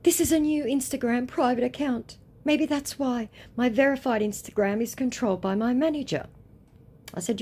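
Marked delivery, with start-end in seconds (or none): 0.0-6.3 high-pitched mock pleading; excuse-making scammer. Scammer explaining away the account, high and mocking. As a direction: offer a lame excuse in a high voice.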